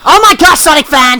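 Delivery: high, loud, fed-up cry of the name; exasperated outburst. Real, not acted. Telling off the interrupting friend, exasperated and loud. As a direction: throw up your hands at the interruption. Interrupted again by Sonic Fan.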